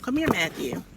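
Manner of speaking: plain reading voice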